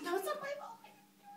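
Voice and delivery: very high, small-voiced denial; tiny high protest, trailing away; protesting blame. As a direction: plead innocence high, fading to almost nothing. Answers the haunting accuser.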